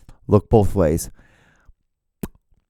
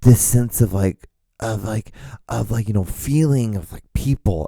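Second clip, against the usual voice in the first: put-on higher, dreamy gushing girl voice; 4 semitones higher than usual. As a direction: gush about a trip abroad.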